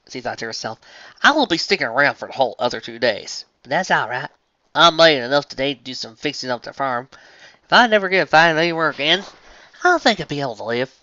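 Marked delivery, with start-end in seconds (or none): 1.2-10.9 southern farmer voice. Acted. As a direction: settle it like a tired farm pony. Applejack speaks to hall.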